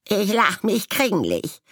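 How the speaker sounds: high, loud croaking scoff; mock the other raven; croaking mockery. A narrator's reading. Striped raven croaking mockery at the blue-spotted one. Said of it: "krächzte ein rosagrün gestreifter".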